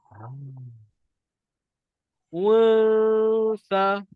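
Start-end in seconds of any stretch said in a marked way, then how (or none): 2.3-4.0 loud unsa, tongue out, nasal hum held; demonstrated unsa. Shown as a demonstration. Teacher showing tongue position to students, nasal unsa. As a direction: say unsa loudly for the class. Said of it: "Tengok lidah saya betul-betul".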